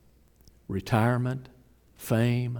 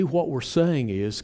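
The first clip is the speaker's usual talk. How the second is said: ordinary delivery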